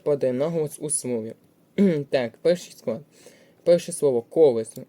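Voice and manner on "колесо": колесо with a loud stressed ко; stress on first syllable. Shown as a demonstration. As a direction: hit ко at the start of колесо. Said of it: "падає на перший склад".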